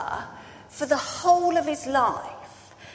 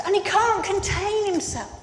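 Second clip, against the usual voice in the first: ordinary delivery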